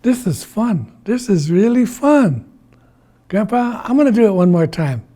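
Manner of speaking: high-pitched delight; excited, eager child-like glee; gleeful. Acted. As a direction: bubble over with fun. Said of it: "He's laughing".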